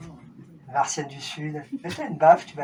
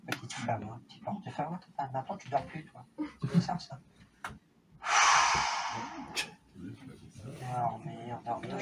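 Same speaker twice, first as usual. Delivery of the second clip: much softer, low murmured induction; sleep induction; 4 semitones lower, 10 dB quieter than usual. Acted. Hypnotist to a volunteer, soft low sleep murmur. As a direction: murmur them back to sleep.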